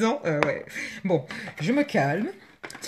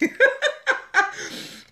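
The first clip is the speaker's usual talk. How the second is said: squealing high laughter; high giddy laugh. Real, not acted. Vlogger laughing for joy over her purple unboxing. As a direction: let a delighted laugh burst out.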